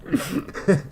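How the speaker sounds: a high, breathy giggle fit; giggling uncontrollably at the chat. Real, not acted. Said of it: "I don't know why I'm laughing".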